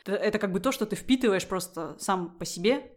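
ordinary delivery

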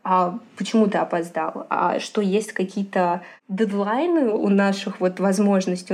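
ordinary talk

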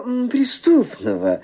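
ordinary delivery

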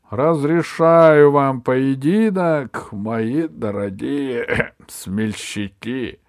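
slow, loud royal proclamation; booming warrior king. Acted. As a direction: boom it out like a king. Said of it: "воскликнул король".

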